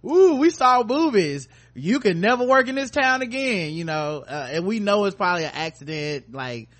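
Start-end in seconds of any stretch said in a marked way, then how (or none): 0.1-3.7 high, loud pearl-clutching outrage; mock scandalized. Mocks people outraged by nudity.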